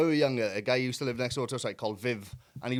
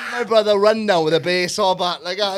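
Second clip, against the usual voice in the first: normal talking voice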